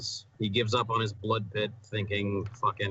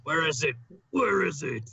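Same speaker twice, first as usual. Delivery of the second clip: mock TV monologue; 6 semitones higher, 3 dB louder than usual. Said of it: "why are you talking aloud".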